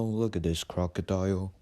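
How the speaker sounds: normal talking voice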